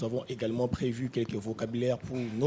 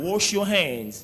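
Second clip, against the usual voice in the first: higher, slow "Wash your hands" with stress on Wash; emphatic drill; 6 semitones higher, 4 dB louder, slower than usual. Shown as a demonstration. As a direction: drill it loudly for the kids. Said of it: "One more time". ("Wash your hands" appuyé)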